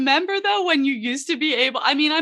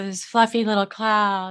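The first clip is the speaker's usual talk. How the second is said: singer impression; 5 semitones lower than usual. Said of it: "like that".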